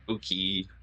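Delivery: "Oki" said as oh-kee; Oki pronounced. Shown as a demonstration. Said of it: "Pronounced like Oki".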